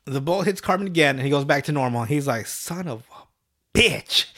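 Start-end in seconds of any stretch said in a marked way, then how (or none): 2.5-4.3 slow, drawn-out curse; mock mad. Retelling the character back to normal and mad, drawn-out mock-angry curse. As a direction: draw out the swear in mock anger. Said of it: "He's mad as fuck".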